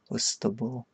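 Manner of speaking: Ustbal softly as "oos-tuh-bul"; tentative pronunciation. Real, not acted. Streamer testing an odd name, Ustbal as soft "oos-tuh-bul". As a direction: try Ustbal quietly in three syllables, "oos-tuh-bul". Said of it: "How am I supposed to pronounce that".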